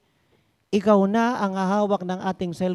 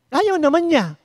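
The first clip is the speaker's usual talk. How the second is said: indignant; 6 semitones higher, 6 dB louder than usual. Real, not acted. Preacher exclaims in indignation.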